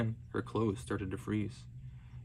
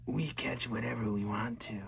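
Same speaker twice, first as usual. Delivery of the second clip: whisper like blowing snow. Acted. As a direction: breathe it out like wind over ice. Qualupaluit threaten the girl.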